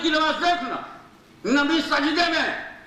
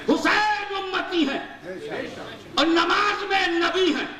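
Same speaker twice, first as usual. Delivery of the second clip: rising, ringing sermon cadence, pitch climbing with each line; preaching at full force; 6 semitones higher than usual. Acted. Preacher rousing the congregation about Husain in prostration, impassioned sermon. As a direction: hammer each phrase home to the crowd.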